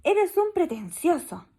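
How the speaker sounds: slow reproachful jab; scornful retort. Acted. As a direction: scold him with contempt. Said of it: "le alegó ella".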